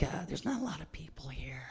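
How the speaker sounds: hushed, breathy whisper. Retold or imitated. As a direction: whisper it like a secret. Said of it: "He's like".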